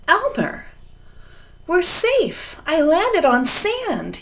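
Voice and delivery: high, loud, relieved yell. A narrator's reading. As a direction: shout the good news. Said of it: "yelled the dragon".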